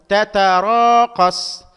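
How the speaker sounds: loud, very slow "تتراقص"; drawn-out long vowel. Shown as a demonstration. Teacher analysing the word for students, drawn-out long vowel. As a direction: stretch the high "را" in "تتراقص". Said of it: "مد مع ممدود".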